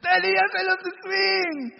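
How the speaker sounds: put-on high, loud, tearful child's cry; little daughter crying. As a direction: cry it out like a hurt little girl. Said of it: "she says".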